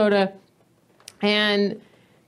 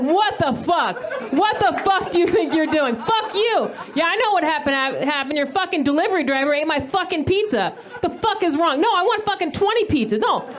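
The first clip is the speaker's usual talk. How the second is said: furious high-pitched rant at the pizza place; hammed-up furious phone tirade; angry yelling; 6 semitones higher than usual. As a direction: yell down the phone, outraged. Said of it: "I'm just yelling at this person".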